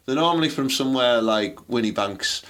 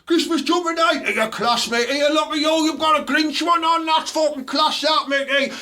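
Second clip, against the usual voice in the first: mock lad voice. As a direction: ham up a boozy lad on a night out. Mocking lads on Christmas night.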